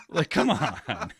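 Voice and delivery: slow, laughing through the words. Real, not acted. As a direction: laugh it out in disbelief. Laughing at subtitle joke.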